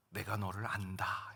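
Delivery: low, slow and tender; God's gentle voice. Retold or imitated. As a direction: voice of God heard inwardly. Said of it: "이런 한마디 말씀이 들리더라고요".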